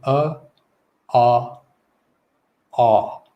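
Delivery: uh, ah, ah with pauses between; isolated vowel steps uh, ah, ah; three jaw positions. Shown as a demonstration. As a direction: open the jaw further from uh to ah. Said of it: "it's that middle position".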